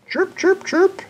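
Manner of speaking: high birdy chirp chirp; bird chirps. Acted. Bird sound in story.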